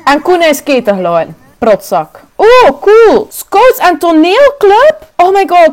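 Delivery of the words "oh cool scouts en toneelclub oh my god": high, slow excited cry; thrilled by the news; gleeful; delighted. Real, not acted. Delighted by club options.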